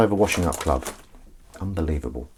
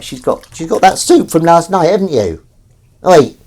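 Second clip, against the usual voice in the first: pet talk; 8 semitones higher, 10 dB louder than usual. Real, not acted. Talking to pet.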